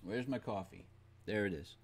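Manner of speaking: ordinary talk, nothing marked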